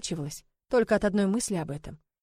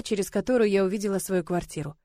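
ordinary delivery, nothing marked

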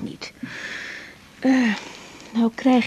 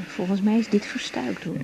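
ordinary talk

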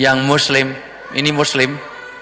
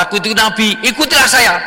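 high, rising urgent declaration; raised-pitch acted appeal; insistent proclamation; 8 semitones higher, 3 dB louder than usual. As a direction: proclaim it and call them to follow. Acted prophet proclaiming himself to disbeliever.

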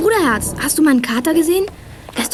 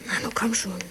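whispered, urging the phone to connect; impatient whisper; 4 semitones lower, 13 dB quieter than usual. Acted. Girl waiting on the phone line, impatient whisper. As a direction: breathe it under your breath, impatient.

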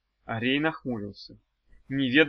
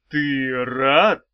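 loud, drawn-out surprised question; 7 dB louder than usual. A narrator's reading. Swordsman seeing his pupil's poorly hidden joy, surprised. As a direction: ask it in open surprise, punching «рад». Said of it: "удивился он".

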